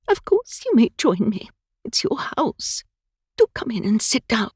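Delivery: a nervous twitterer trying to be gracious; twittering old woman. Acted. Flustered old woman startled.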